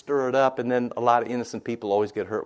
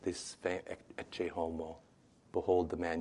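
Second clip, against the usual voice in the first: hushed Pilate; 4 semitones lower, 8 dB quieter than usual. Acted. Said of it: "Pilate said".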